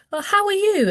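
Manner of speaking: put-on high, lilting adult greeting to a child; high friendly greeting to a child; sing-song greeting. Shown as a demonstration. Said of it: "sometimes you might say".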